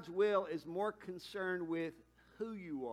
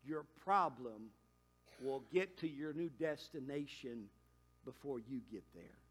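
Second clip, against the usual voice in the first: measured counsel; 4 semitones lower, 6 dB quieter than usual. Retold or imitated. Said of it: "I said".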